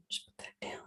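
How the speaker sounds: barely audible whisper; whispered aside. Real, not acted. Quick aside to co-host before starting, whispered. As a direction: whisper it under your breath.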